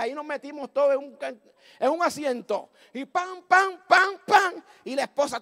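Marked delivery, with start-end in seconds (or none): 3.0-4.6 high, punched "pam" bangs; imitated stone impacts. Stones pelting the crowded van, imitated bangs. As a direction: fire off each hit separately.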